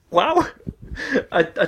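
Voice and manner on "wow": mock amazement. Acted reaction to the old dolls.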